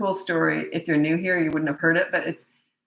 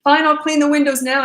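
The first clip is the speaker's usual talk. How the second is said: loud, high mock protest; mock grudging child; 9 semitones higher, 7 dB louder than usual. Kid giving in after the blackout starts, mock grudging. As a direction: give in loudly, like a cross kid.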